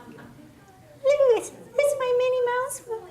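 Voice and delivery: very high squeaky mouse voice; Minnie Mouse. Shown as a demonstration. Coach redoes the exercise for the group, Minnie Mouse voice. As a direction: pipe it from the top of your range. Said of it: "now do the Minnie Mouse one again".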